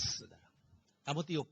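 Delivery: ordinary talk, nothing marked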